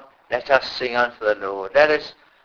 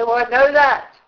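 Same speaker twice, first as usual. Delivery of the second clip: high, louder know-it-all brush-off; dismissive retort; 10 semitones higher, 4 dB louder than usual. Pretend. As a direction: toss it off as if it's old news. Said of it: "You can say".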